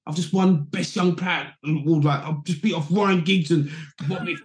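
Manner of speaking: put-on young player celebrating his award at the table; buzzing. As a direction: ride the high of winning.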